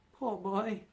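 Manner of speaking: said in pity, on the edge of tears. A narrator's reading. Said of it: "he said in pity to his former self".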